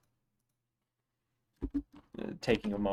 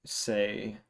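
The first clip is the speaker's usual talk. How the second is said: ordinary delivery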